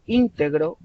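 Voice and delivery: "íntegro" stressed on ÍN; ÍN-te-gro, front-stressed; stress on first syllable. Shown as a demonstration. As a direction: hit the opening syllable of "íntegro". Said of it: "sílaba tónica al inicio".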